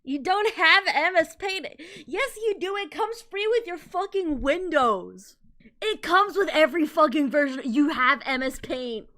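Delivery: exasperated rant. Real, not acted. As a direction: lecture the screen in disbelief. Ranting at the video.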